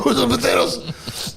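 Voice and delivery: low, slow mock-Greek voice; gruff husband impression. Husband's part in the restaurant quarrel, low slow voice. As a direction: drop deep and drag it out.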